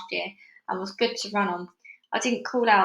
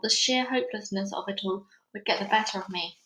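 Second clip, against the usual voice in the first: usual speaking voice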